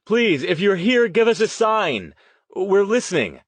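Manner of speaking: high, loud plea; calling out loudly. A narrator's reading. Riley begging the ghosts for a sign, calling out loudly. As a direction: call out pleadingly to someone unseen. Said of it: "Riley called out more loudly".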